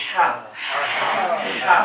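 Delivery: हा pushed from the middle of the throat. Shown as a demonstration. Teacher repeats हा for the class, pressed. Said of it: "दबाव पड़ रहा है".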